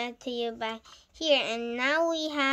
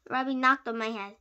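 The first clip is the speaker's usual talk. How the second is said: usual speaking voice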